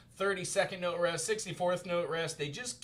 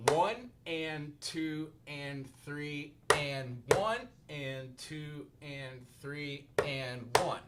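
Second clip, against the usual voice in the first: very slow counting; much slower than usual. Shown as a demonstration. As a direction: spread the count out, slow and deliberate. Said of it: "just slow it down with me".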